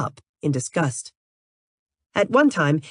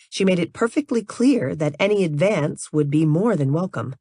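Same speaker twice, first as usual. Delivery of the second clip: ordinary delivery, nothing marked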